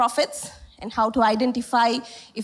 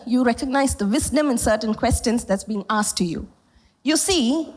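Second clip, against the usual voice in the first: ordinary talk, nothing marked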